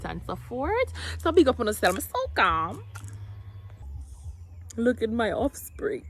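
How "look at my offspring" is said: whispered in awe, slowly. Real, not acted. Emotional moment over her baby.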